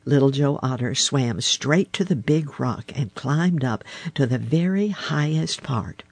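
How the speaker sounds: usual speaking voice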